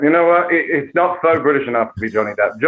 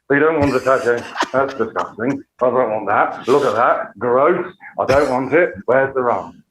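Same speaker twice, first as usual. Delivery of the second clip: fussy, disgusted tipsy pirate; slurred British pirate recoiling in distaste; hammed-up drunken pirate; Captain Jack. Shown as a demonstration. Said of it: "go full Captain Jack".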